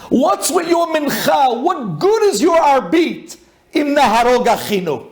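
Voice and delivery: full-force sermon peak, pitched high and slowed; fiery preaching. Real, not acted. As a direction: hammer each question at the congregation. Preacher builds to fiery climax.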